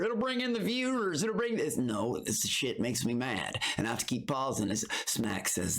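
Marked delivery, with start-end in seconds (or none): 0.0-1.8 high mocking imitation of the advisers. Reacting to the show, mimics advisers in high mock voice. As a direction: parrot what people kept telling him, mocking. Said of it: "people have been telling me".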